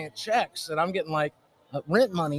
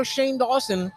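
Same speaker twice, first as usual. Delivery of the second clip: ordinary talk, nothing marked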